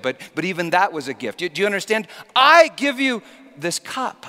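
loud, high fervent sermon voice as God; preaching at full force. Acted. Preacher voicing God to the congregation, loud and fervent. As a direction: thunder it out as God pleading with his people.

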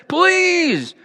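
high, desperate please; pleading. A narrator's reading. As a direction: beg for mercy. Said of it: "the prince protested".

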